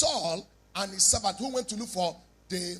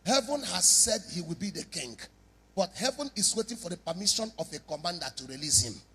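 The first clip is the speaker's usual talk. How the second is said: ordinary talk, nothing marked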